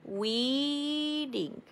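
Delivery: Weeding held long, rising then falling; Weeding drawn out long. Shown as a demonstration. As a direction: stretch out Weeding like a song. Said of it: "If you say it long like that".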